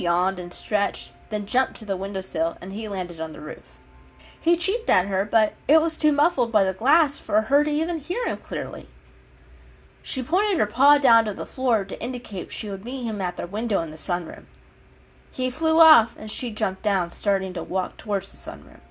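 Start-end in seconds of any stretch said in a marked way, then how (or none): none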